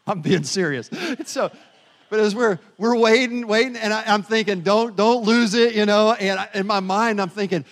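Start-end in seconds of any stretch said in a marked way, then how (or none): 4.6-5.8 loud, slow, strained self-talk; tense self-warning. Retold or imitated. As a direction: hold your temper, say it slowly and loudly. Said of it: "get a little bit flustered in my mind".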